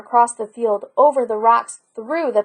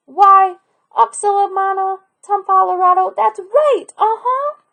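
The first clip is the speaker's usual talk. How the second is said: put-on high, louder delighted old woman; granny beaming at her grandson, pitched up; 8 semitones higher, 5 dB louder than usual. Granny pleased, grandson knows her name.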